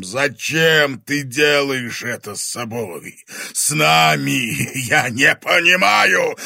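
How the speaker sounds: slow, loud monster wail; anguished roar. A narrator's reading. Dragon asking why the boy does this, anguished roar. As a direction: demand an answer in agony. Страдающий дракон взывает к Тимофею.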